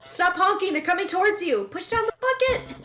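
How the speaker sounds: rapid-fire warning over the action; urgent game callout. Real, not acted. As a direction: call out frantic directions to a teammate. Urgently directing a partner in game.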